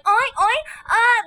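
high, drawn-out moans; mock sex noises. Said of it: "when I do sex noises".

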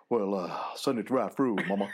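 a hint of the King. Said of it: "[name]'s impression of [name]".

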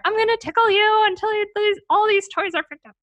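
high, loud, silly teasing; playful tickle threat. Acted. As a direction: tease a child with a mock tickle attack. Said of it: "and just be silly".

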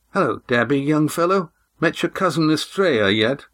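a hint of a brisk weary old woman; tired old woman. A narrator's reading. Miasa waving the three to sit, brisk. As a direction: wave them down, lightly voiced.

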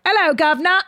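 mock Cockney "Hello, governor" tossed off lightly; East Ender accent. Friend demos East Ender accent for her stage entrance, mock Cockney. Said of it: "talk with, like, an East Ender accent".

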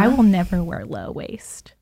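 whispered vow, no voice. Retold or imitated. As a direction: whisper it like a private promise. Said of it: "I'm like".

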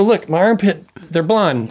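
high, eager show-and-tell; mock excited look-at-this. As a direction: point it out like a proud kid. Showing off armpit hair to stranger.

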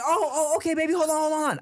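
high, loud mock panic; flustered dad. Flustered dad acted in retelling.

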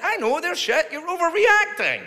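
high-pitched dismissive protest; retold exasperated retort. Comic answering complaint about his sketches, high mock exasperation. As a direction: fire back, pitch shooting way up. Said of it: "I went".

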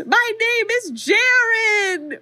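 high, slow, drawn-out scream of his own name. Retold or imitated. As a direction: wail the name out like a desperate cry. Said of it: "And Jaren screams".

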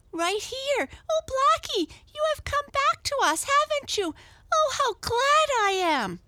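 very high, loud delighted squeal; overjoyed little girl. A narrator's reading. Girl overjoyed at cat's return.

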